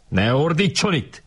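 prefect's high-pitched telling-off; shouted reprimand. A narrator's reading. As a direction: order the noisy boy to stop. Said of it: "kiáltott rá a szobafőnök".

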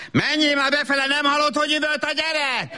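sudden high-pitched nagging outburst; exasperated order. Acted. Wife handing child to husband, suddenly exasperated. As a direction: switch abruptly to a high, impatient command.

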